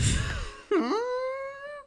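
shrill cracking-up laugh, almost crying; high squealing laugh. Real, not acted. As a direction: lose it completely, laughing. Cracks up after the retort.

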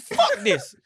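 defiant outburst. Retold or imitated. Retold defiance, very high.

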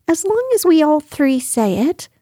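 high-pitched small creature voice, lifting at the end; high little voice, tentative rise; timid little figure. Acted. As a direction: say it high, with a rising finish. Piglet anxiously setting his condition.

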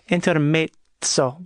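intermezzo with zz said as ts, slowly; Italian pronunciation. Shown as a demonstration. Teaching the listeners that zz sounds like ts, slowly. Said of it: "it's like a T sound".

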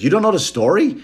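a loud teasing finance bro; brash Wall Street guys. Retold or imitated. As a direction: rib the comic like smug bros. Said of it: "He goes".

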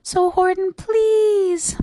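high, tiny voice begging; little mayor's high-pitched plea; pleading mayor. A narrator's reading. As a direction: plead with a drawn-out high PLEASE. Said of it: "pleaded that voice of the mayor's".

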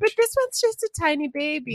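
high-pitched, smitten coo; cooing plea. Real, not acted. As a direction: plead in a small high voice over something adorable. Pleading for the baby koala's cuteness.

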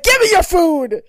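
loud, high demanding Tyra; demanding shout. Retold or imitated. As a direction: grab the food, shouting. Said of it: "like, is like".

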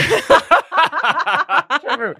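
laughing up high. Real, not acted.

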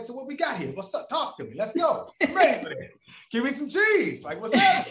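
high, hyped-up and laughing; cocky hype. Retold or imitated. He hypes himself up before the interviewer, cocky and laughing. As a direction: pump yourself up, swaggering.

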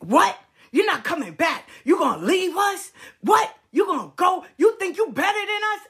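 outraged friend yelling he's leaving; angry shouting friend. Retold or imitated. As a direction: hurl it at him like a betrayed friend. Friend angrily confronting Will.

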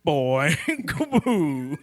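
slow drawn-out silly boom; drunk cartoon bear. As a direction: stretch it out slow and dopey. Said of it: "sounds like a real, drunk Yogi Bear".

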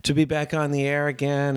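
usual speaking voice

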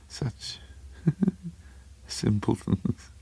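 soft, breathy, wandering mad mutter; muttering to himself. A narrator's reading. Little man babbling to himself in the cinema, breathy muttering. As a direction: ramble under your breath, pitch jumping about. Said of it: "The man was talking to himself again".

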